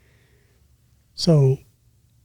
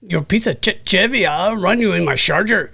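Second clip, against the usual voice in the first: put-on Mexican accent; 3 semitones higher than usual. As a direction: tease Busby in a Mexican accent. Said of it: "It is a pretty good Mexican accent".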